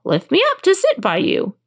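high-pitched frog demand; frog crying out. A narrator's reading. Frog asks princess to lift him, high frog cry. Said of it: "Then he stopped and cried".

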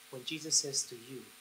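ordinary talk, nothing marked

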